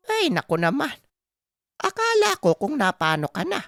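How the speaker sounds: put-on relieved, scolding old mother, high-pitched; Aling Pasing. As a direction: fuss at your son in a high voice. (inang nanenermon pero panatag na, matinis ang boses)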